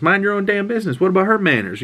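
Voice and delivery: high, sharp put-on scold; snippy woman. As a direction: pitch up and tell her off. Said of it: "she goes".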